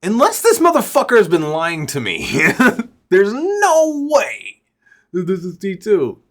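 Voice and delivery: incredulous, high and loud, breaking into a laugh; retold astonished outburst; loud disbelief. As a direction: stretch it out high in mock disbelief. Reenacts his disbelief at the lookalike.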